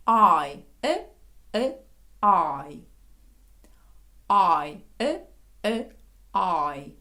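loud drawn-out I as "ai", brief neutral е; long-short vowel drill. Shown as a demonstration. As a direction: alternate wide gliding I with short е. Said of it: "коли ми просто відкрили рота". (вправа: протяжне I як «ай», коротке «е»)

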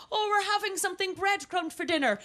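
put-on high, gleeful kid at dinnertime; overjoyed child announcing good news; childlike enthusiasm. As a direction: build to a long, loud cheer. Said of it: "go-to word for enthusiasm".

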